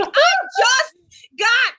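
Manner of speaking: high, loud, laughing shriek of disbelief; just home and hearing of the robbery, a high loud yell; exasperated yell. Retold or imitated.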